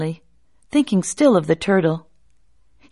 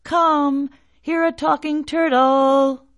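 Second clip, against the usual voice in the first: calling out; 8 semitones higher, slower than usual. Acted. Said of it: "and called".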